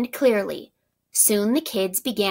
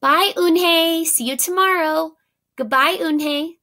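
higher, louder sing-song farewell; cheery goodbye; 8 semitones higher, 4 dB louder than usual. A narrator's reading. New friends call goodbye to Eunhae, high and cheery. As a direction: wave her off warmly. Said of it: "Eunhae heard her new friends say goodbye".

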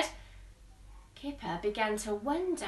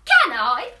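louder eager pleading; begging; 9 dB louder than usual. Acted. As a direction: beg to have a turn. Pig begging to press button.

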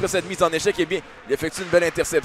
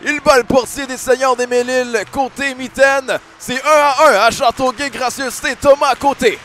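raised, lively goal recap; excited sports commentary; 5 semitones higher, 5 dB louder than usual. Real, not acted. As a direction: keep the goal buzz going. Colour man after goal. (commentaire sportif enthousiaste)

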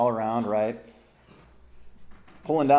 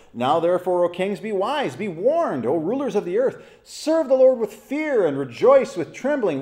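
rising, louder preaching read of the verse; fervent scripture reading; 4 semitones higher, 3 dB louder than usual. Acted. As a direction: build the verse toward a call. Rereads verses, building intensity.

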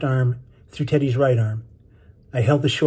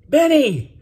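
high, louder holler; calling out; 13 semitones higher, 5 dB louder than usual. A narrator's reading. Boy searches dark sea for Benny, calling out. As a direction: cry the name out high over wind and waves.

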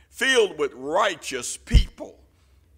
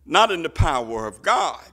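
ordinary delivery

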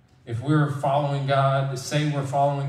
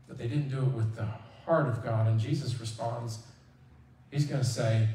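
ordinary talk

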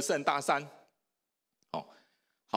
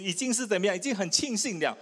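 ordinary delivery

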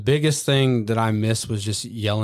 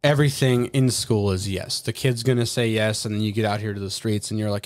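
normal talking voice

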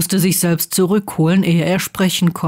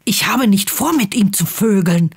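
hissed. A narrator's reading. Harry rejects Ron's suggestion, mildly hissed. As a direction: protest it with a touch of outrage. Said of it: "hisste er".

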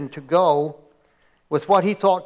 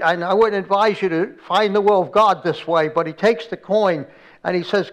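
normal talking voice

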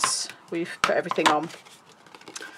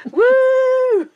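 high, loud cheer; excited whoop; 8 dB louder than usual. Real, not acted. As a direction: whoop with glee. Cheering Nan's arrival.

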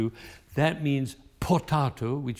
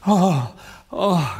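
cry of pain; 4 semitones higher, 6 dB louder than usual. Shown as a demonstration. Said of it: "a cry of pain".

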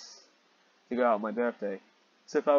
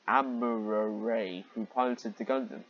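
ordinary talk

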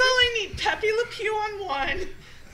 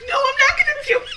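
laughing protest; 7 semitones higher, 6 dB louder than usual. Retold or imitated. Retold drunk denial in car.

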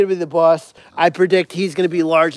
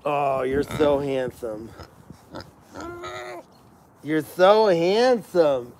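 soft cooing; 3 semitones lower, 5 dB quieter, slower than usual. Real, not acted. Cooing softly to the pig.

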